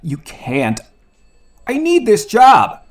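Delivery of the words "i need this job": loud, high, slow desperate cry; desperate plea. Acted. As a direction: beg with everything you have. Begging not to be fired.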